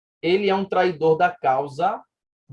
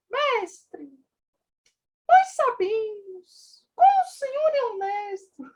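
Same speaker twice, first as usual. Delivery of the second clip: much higher, theatrical Greek tragedy voice; dramatic tone; slower than usual. Shown as a demonstration. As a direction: declaim it like an actor in a Greek play. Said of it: "Vamos fazer um tom dramático aqui, como nas peças gregas".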